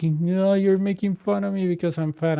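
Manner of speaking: debt collector mocking a crying caller's whine. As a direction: mimic her sobbing complaint, half-hearted. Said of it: "she started crying".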